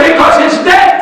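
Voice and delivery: punchline shout. Retold or imitated. Comic shouting a retold punchline.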